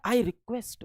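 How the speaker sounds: quoting the student in a soft, small voice; imitated humble request. Teacher quoting a still-pleading student, soft imitated request. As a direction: drop right down for a quiet plea. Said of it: "और अभी भी वो क्या कर रहा है?".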